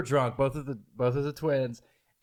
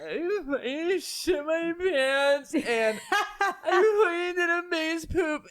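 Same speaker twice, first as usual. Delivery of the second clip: much higher, slow mock wail breaking into laughter; drunk crying girl; 4 dB louder, much slower than usual. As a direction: ham up the sobbing teen confessing. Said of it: "that's how she talks".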